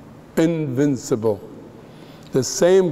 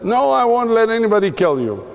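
impassioned, higher-pitched protest, acted out; zealous; 7 semitones higher than usual. Peter vows to protect Jesus from being killed, zealous. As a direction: imitate a zealous disciple vowing protection. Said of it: "he was so zealous".

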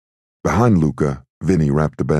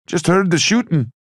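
Irish brogue. A narrator's reading. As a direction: call back in an Irish lilt. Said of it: "someone with an Irish brogue said".

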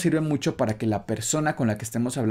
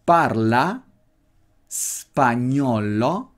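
spagnolo stretched out very slowly; slow drill for learners; very slow demo; much slower than usual. Shown as a demonstration. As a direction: drill parla spagnolo, spagnolo dragged out. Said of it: "Otra vez".

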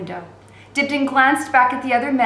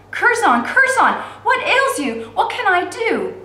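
much higher, urgent cry of worry; alarmed plea; 12 semitones higher, 5 dB louder, slower than usual. A narrator's reading. Girl cries out to her feverish brother, high alarmed plea. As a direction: call out to him in panic.

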